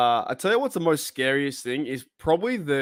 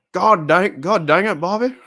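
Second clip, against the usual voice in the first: loud mock Hank Hill; 4 semitones higher, 8 dB louder than usual.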